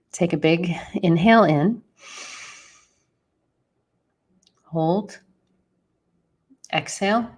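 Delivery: soft, sparse meditation cues with long pauses; guided breathing. Real, not acted. Host guiding listeners to inhale, hold and exhale, softly.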